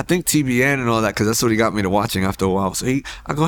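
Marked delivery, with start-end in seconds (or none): none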